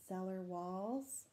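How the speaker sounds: plain reading voice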